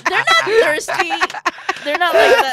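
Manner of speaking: defending friends loudly over laughter; loud insistence. Real, not acted.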